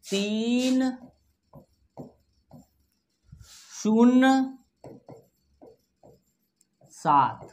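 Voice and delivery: slow, separated digits; digit-by-digit dictation. Shown as a demonstration. Teacher reading digits after the decimal, slow dictation. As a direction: draw out each digit, then leave long pauses. अंक पढ़ेंगे.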